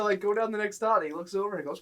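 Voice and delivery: ordinary talk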